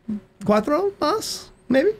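coaxing Spanish aside, hammed up; mock Mexican accent. The soccer player coaxes the losing football players, in a mock accent. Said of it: "I've got a terrible accent".

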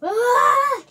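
high, loud "ah"; shout. Real, not acted. Vlogger yelps out while setting up, high loud cry. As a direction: let out a sudden high shout.

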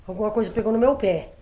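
ordinary talk

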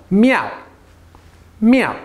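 short falling MEOW; fourth tone. Shown as a demonstration. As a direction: MEOW high, dropping sharply. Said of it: "We got fourth tone though".

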